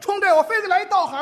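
high, loud, fast vow; defiant spectator. Acted. Offended audience member vows to heckle the actor, loud and defiant. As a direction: rush it out in mock outrage.

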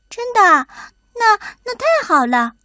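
high, excited little mole; light up at the offer, voice jumping high; delighted surprise. A narrator's reading.

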